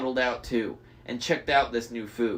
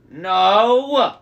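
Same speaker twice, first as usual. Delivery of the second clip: moaning; 7 semitones higher, 9 dB louder than usual. A narrator's reading. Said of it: "Cersei moaned".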